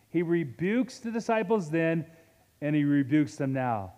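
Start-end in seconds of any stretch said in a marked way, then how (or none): none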